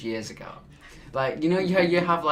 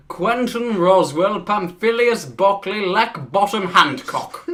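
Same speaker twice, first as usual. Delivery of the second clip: loud, high, hammed-up posh accent; 6 semitones higher, 9 dB louder than usual. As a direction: proclaim the names like a pompous toff. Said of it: "the most posh voice you can".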